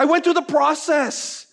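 usual speaking voice

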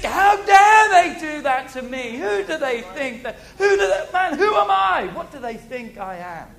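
hammed-up wounded pride, rising to a squeak; mock outrage. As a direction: rant like someone deeply offended. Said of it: "because we think".

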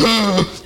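acted gasp of dismay; mock gasp. Child caught dropping stolen candy, mock gasp.

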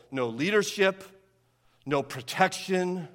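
ordinary talk, nothing marked

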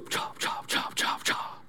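whispered rapid chop sounds; voiceless, percussive karate blocks; imitated blocking noises. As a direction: softly mimic swift hand strikes. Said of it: "just simply goes".

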